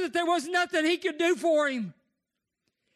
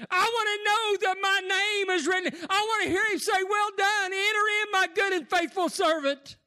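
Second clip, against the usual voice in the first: fervent preaching; 6 semitones higher than usual. Acted. Preaching at full force.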